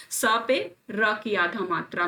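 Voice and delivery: ordinary talk, nothing marked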